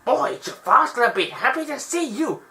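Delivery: Iago pleased. Retold or imitated. Iago gloating over the stolen lamp, in a high gleeful voice. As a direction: gloat like a sneaky sidekick bird. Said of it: "he was like".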